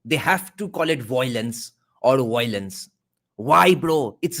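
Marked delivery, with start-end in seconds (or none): 1.0-2.9 "violence" said as voilence, then woilence; regional mispronunciation. Retold or imitated. Mocking non-Bengali speakers, "violence" as voilence or woilence. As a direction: turn the vowel in "violence" to oy, then v to w. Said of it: "can ever pronounce the word violence properly".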